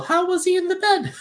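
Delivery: very high put-on nanny voice; pry in a high, hammed-up nanny voice; Mrs. Doubtfire. Co-host imitating the nanny at the kitchen table, high voice. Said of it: "when she's breaking her down".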